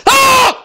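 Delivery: short, high, loud "Ah!"; abrupt high-pitched yell; sudden scream. Pretend. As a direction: blurt out a piercing shout. Mimes typed capital-letter scream in comments.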